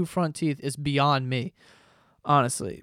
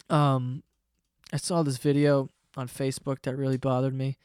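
ordinary talk

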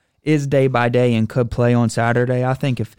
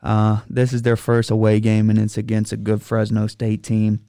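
ordinary talk, nothing marked

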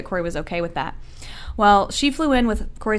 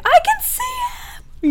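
high, very slow and wondering; dad stunned at seeing his baby born, awed slow high voice; awestruck dad; much slower than usual. Retold or imitated. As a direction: marvel at first sight of the baby. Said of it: "And he's like".

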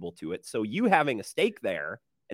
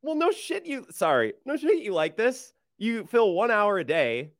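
high, lively teasing; excited retort; 8 semitones higher than usual. Real, not acted. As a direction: tease him, pitch way up. Teasing outburst.

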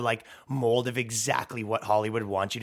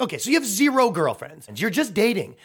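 higher, louder, mocking disbelief; incredulous retort; 10 semitones higher, 4 dB louder than usual. Answering a polyamorous New Yorker, mocking higher retort. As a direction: call out the nonsense, pitched up. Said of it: "You're like".

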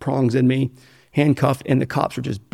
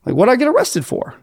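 incredulous retold question; 10 semitones higher than usual. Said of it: "I was like".

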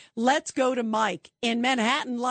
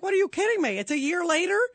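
incredulous; 7 semitones higher, faster than usual. Retold or imitated. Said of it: "And I'm like".

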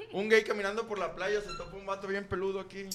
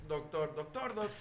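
stuttering patient; 3 semitones higher, 9 dB louder than usual. Pretend. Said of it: "No puedo hablar".

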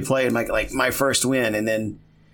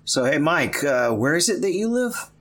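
teacher voice; 3 semitones higher than usual. Acted. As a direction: ask like a kindergarten teacher. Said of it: "with my teacher voice now".

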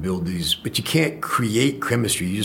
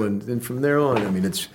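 ordinary delivery

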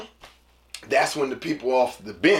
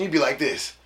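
ordinary talk

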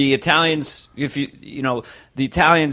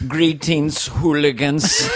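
slow, grand film-villain greeting, pitched up; Christopher Waltz; 3 semitones higher, much slower than usual. Retold or imitated. Nervous man cornered by bikers, Christopher Waltz voice. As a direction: draw out the greeting in an exaggerated accent. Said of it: "he goes into Christopher Waltz".

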